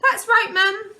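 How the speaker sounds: put-on high loud little boy voice; small boy agreeing. A narrator's reading. Luke backing up his brother, high and loud. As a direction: chirp it like a tiny boy. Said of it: "he agreed".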